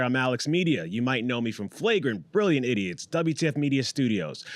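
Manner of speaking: normal talking voice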